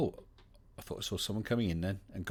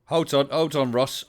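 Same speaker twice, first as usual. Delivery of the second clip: calling out; 6 semitones higher, 9 dB louder than usual. Real, not acted. Calling to guest, no sound.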